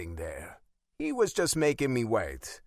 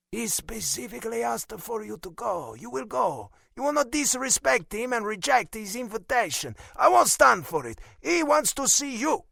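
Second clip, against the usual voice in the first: put-on high Italian-accented scolding, building louder; stern Italian father, angry; 12 semitones higher, 4 dB louder than usual. A narrator's reading. Father refusing his son's excuses, angry Italian accent. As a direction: lay down the law, rising to loud.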